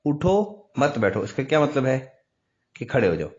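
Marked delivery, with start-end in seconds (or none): 0.0-1.2 "اٹھو" drawn out and halted before "مت بیٹھو"; pause after اٹھو. Shown as a demonstration. Teacher repeats the example for students, break after اٹھو. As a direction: hold and stop on "اٹھو", then "مت بیٹھو". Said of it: "اب میں نے اٹھو پر رکا".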